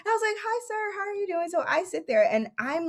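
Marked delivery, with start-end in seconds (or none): 0.4-1.5 starstruck politeness. Retold or imitated. Meeting her idol, trying not to freak.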